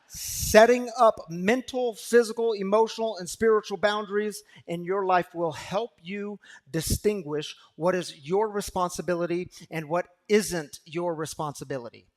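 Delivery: preaching. Acted. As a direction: preach it out loud to the congregation. Preaching at full force.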